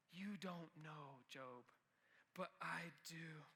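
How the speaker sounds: almost breathe it, slowly; hushed slow God voice. Retold or imitated. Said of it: "He's saying".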